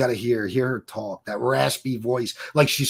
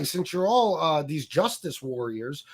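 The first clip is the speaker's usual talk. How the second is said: ordinary talk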